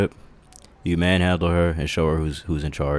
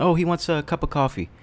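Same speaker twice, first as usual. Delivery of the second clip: mock clueless person; 11 semitones higher than usual. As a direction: squeak it out like someone who just figured it out. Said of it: "and be like".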